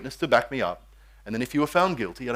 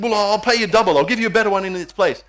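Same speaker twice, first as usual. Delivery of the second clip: higher and louder insistent offer; pushy king; 7 semitones higher, 6 dB louder than usual. Acted. King Ahab offers Naboth double, higher and louder. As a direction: press the bid, voice up.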